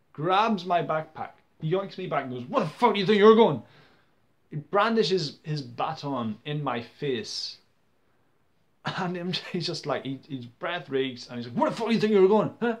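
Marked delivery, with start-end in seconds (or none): 2.5-3.6 loud, high-pitched aggressive challenge, imitated; angry yell. Man with a baton yanks him back, angry yell. 11.6-12.8 imitated aggressor, high and loud; angry yell. Parade man shouting in his face, angry yell.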